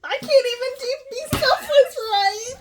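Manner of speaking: high, loud mock outburst; mock exasperation. Friend imitating her reply to the trust fund, high mock exasperation. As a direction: throw it out high and loud, as a hammed-up protest. Acted outburst at his confession.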